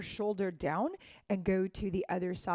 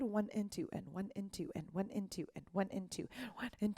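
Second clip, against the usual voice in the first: whispered counting; 9 dB quieter than usual. Shown as a demonstration. Dance teacher counting for students, in a whisper.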